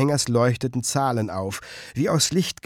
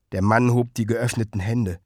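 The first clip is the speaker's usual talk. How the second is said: plain reading voice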